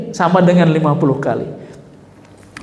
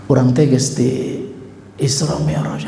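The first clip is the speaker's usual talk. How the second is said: slow, hushed whisper; 4 semitones lower, 4 dB quieter, slower than usual. Acted. The Prophet quietly confiding his journey to his cousin. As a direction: confide it under your breath.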